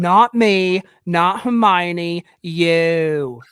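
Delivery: a hint of British accent, slow on Hermione; mock British accent as Harry Potter; light British accent. Shown as a demonstration. Friend asked for an accent, a light British accent. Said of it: "Can you do any accents?".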